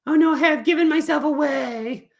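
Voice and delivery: high dismayed kitty, laughing; puppet cat voice. Acted. Cat puppet realises she confessed, high and laughing. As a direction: gasp at being caught, as the cat. Fluffy gives herself away.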